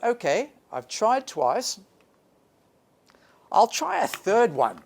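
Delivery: rally yourself for another try; excited thought. Retold or imitated.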